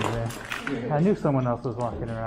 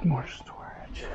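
whispering; 6 dB quieter, much slower than usual. Real, not acted. Quiet exploring in abandoned school.

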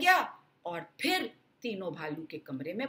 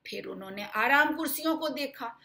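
usual speaking voice

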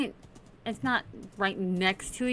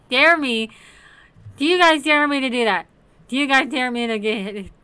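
excited; 4 semitones higher, 10 dB louder than usual. Real, not acted. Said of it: "asks viewers loudly".

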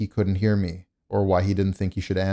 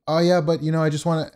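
higher-pitched voice of a persistent seller; imitated salesman; 7 semitones higher than usual. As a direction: mimic a salesman trying to keep pitching. Mimics salesman still pitching.

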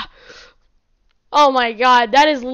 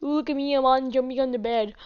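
normal talking voice

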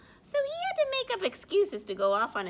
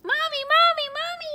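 much higher, louder excited call; 14 dB louder, faster than usual. Acted. Snowy calling Mommy out to see, loud call. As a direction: call across the yard to Mommy. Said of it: "he called".

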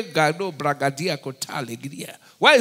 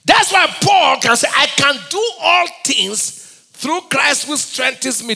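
loud, high, sung-out sermon quote; full-force preaching; 7 semitones higher, 6 dB louder, slower than usual. Acted. Preacher sings out the verse loudly to his flock. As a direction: lift the verse up like a proclamation.

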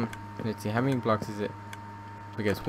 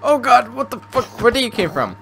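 high, loud startled outburst; startled shout; 11 dB louder than usual. Real, not acted. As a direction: jump out of your skin yelling. Mob suddenly appears.

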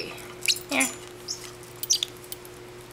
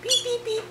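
imitating beeps; 13 semitones higher than usual. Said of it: "You're saying beep".

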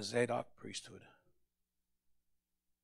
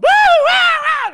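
very high, loud, slow mock whooping; hammed-up "woo" shouts; mocking tongues whoops; 24 dB louder, much slower than usual. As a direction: imitate wild church noises to ridicule. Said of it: "making all kinds of crazy noises".

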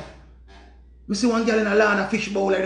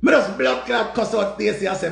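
ordinary talk

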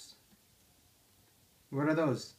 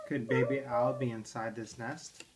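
plain reading voice

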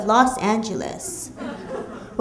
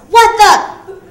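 sudden high shout of shock; jolted high-pitched what; startled yell; 9 semitones higher, 10 dB louder than usual. Retold or imitated. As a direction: yell it like you just got hit. Pedestrian hit by car yells.

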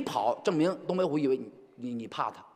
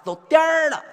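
Beijing erhua, higher and louder; Beijing accent; 12 semitones higher, 8 dB louder than usual. Shown as a demonstration. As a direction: roll the 儿 like a Beijinger. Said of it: "儿化音居多".

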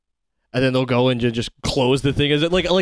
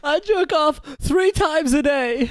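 mock shout; slower than usual. Joking brag, rising into a yell.

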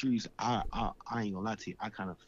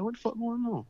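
high, baffled retold demand; alarmed disbelief. Asking his crying brother what happened, alarmed. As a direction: pitch it way up, urgently confused.

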